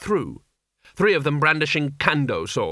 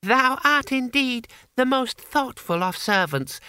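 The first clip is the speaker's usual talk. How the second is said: put-on grandly courteous young ruler; high-pitched formal decree; gracious boy Emperor; 4 semitones higher than usual. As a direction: bestow a poisoned honour with mock graciousness.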